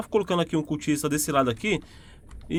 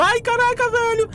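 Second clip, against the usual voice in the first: excited; 3 dB louder than usual. Real, not acted. Fighting the monster.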